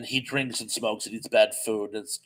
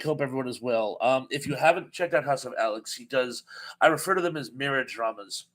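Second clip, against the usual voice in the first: ordinary delivery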